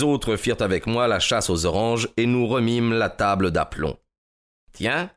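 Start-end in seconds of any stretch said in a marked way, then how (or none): 4.7-5.1 high rising note of surprise; surprised exclamation. A narrator's reading. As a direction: exclaim it, pitch shooting up. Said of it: "s'écria l'inspecteur". (exclamation de surprise, ton montant)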